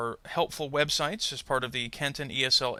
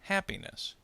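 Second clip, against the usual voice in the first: "Happiness." with "Hap" stressed and higher; stress on first syllable; 6 semitones higher, 5 dB quieter than usual. Shown as a demonstration. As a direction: demonstrate "Happiness." stressed at the start. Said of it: "the stress does not change".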